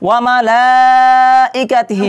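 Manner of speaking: মালাইকাতিহি with the লা held one alif long; lengthened madd. Shown as a demonstration. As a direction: draw out the লা in মালাইকাতিহি. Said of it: "এক আলিফ টেনে পড়তে হবে". (মালাইকাতিহি-র লা এক আলিফ টেনে)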